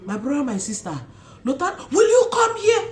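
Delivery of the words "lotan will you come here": high shouted call across the room; mother shouting her son's name, loud and high. Retold or imitated. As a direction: yell for the child to come over. Mother calling child in story.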